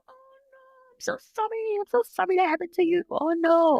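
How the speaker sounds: high, gushing sorry voice of the doctor; sympathetic doctor. Retold or imitated. Doctor apologizing to her patient, high gushing imitation. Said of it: "She said".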